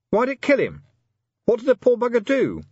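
high, loud exasperated outburst; angry demand. A narrator's reading. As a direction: demand it in frustration. Vimes angrily demanding answers.

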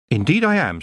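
high, firm reply; young witch. A narrator's reading. Tiffany answering the elf king, high and firm. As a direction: answer proudly.

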